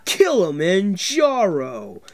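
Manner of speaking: slow, drawn-out announcer call; arena announcer. Pretend. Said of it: "sounded more like an arena announcer".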